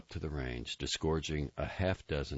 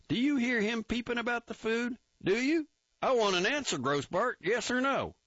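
higher, louder barking officer; angry captain; 4 dB louder than usual. A narrator's reading. Captain grilling a recruit, loud angry officer. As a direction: bear down on him, demanding an answer.

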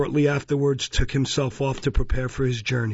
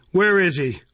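loud, raised-pitch demand; angry demand; 6 semitones higher, 5 dB louder than usual. A narrator's reading. Beadle demanding to see the boy, angrily. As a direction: demand it as an irritated official. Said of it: "said Mister Bumble angrily".

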